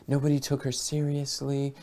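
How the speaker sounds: softly, letting the voice go airy; a touch of a soft, airy voice. Shown as a demonstration. Coach describing a woman nobody took seriously, soft and airy. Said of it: "so soft and it was airy".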